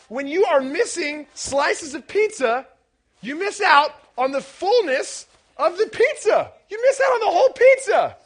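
loud, high-pitched exclaiming; impassioned insistence. Real, not acted. Preacher telling students what missing slices costs, impassioned shout. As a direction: drive the point home at full voice. Preacher driving point home.

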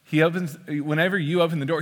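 ordinary talk, nothing marked

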